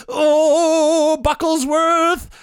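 high, slow, drawn-out sigh of disappointment; exasperated villain. Acted. Robber boss fed up with his clumsy sidekick. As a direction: let the name sag with theatrical despair. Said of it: "I slap my forehead and I'm like".

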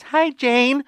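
high, sweet put-on child voice; little girl. Girl answering the door to Jane, little girl voice. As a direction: greet her like a small girl. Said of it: "the girl answers the door".

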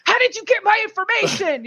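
high-pitched, indignant mimicry of an angry man; imitated freak-out. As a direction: act out a caller losing it. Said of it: "starts freaking out".

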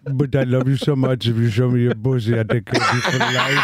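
hammed-up Indian accent, eager suitor; mock Indian accent. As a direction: promise the world in a comic Indian accent. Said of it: "That's very good".

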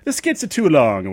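high, loud sing-song sketch imitation; imitating SNL sketch voice. Retelling the juice skit in a high loud sketch voice. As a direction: do the Adam Sandler juice skit. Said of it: "getting it wrong".

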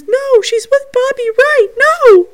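very high, loud mimicked protest; imitating Vicky. Acting out spirit conversation for viewers, high loud imitation. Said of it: "they think that's Vicky".

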